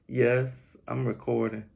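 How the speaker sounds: ordinary delivery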